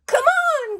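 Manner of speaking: very high, eager call from Mole; crying out. A narrator's reading. Mole calls friends to swimming hole, high eager cry. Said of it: "cries Mole".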